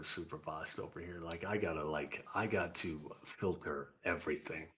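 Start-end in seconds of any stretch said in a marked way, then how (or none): 1.4-4.7 whispered grumble; half-whispered gripe. Real, not acted. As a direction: whisper the complaint under your breath. Complaining quietly about filtering.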